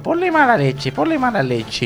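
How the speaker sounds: high, strained silly voice; squeaky intern voice. Acted. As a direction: squeak it out with attitude. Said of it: "sufro con esta voz". (voz aguda de becario)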